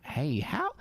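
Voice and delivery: high, friendly Texas twang; Texas accent. Retold or imitated. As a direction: greet sweetly in a Texas accent. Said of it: "the Texas accent that's just like".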